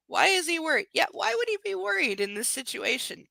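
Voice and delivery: high-pitched, exasperated echo; incredulous sarcasm, voice up; sarcastic disbelief. Real, not acted. Reader mocks heroine's question.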